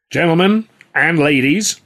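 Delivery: loud, slow showman address; Sullivan's voice. A narrator's reading. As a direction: boom it out like a boss addressing a room.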